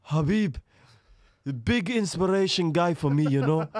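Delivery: high, loud Dagestani-accented impression; Islam Makhachev. Fighter praising his mentor in an interview, loud accented impression. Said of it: "Should I do him an Islam Makhachev?".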